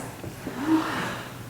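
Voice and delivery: a soft, breathy gasp of a bigger surprise; surprised gasp. Shown as a demonstration. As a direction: suck the air in, startled. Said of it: "Then how about a bigger surprise?".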